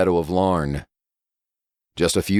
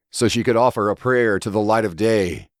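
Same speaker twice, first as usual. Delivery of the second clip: usual speaking voice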